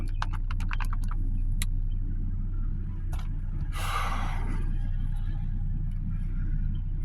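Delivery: long unvoiced sigh out through the mouth; heavy sigh. Real, not acted. Facing the camera, a heavy tired sigh. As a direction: let out a weary breath.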